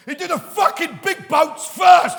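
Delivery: high, very loud, slow punchline; ranting shout. Pretend. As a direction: build to a full exasperated yell. Comic rant reaching its punchline.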